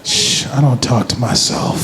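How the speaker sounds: low, slow mock denial; hushing denial. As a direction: hush them and deny it, slowly. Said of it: "they start to go like this".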